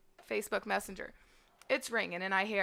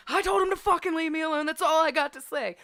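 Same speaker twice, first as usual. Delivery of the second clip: higher, mildly louder, storming past; fed-up shout; 7 semitones higher, 4 dB louder than usual. Retold or imitated. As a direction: fling it over your shoulder on the way to the bathroom. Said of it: "walking straight through my house ... going".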